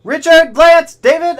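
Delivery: loud, high name calls; excited shoutouts. Real, not acted. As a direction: call the names out with a burst of energy.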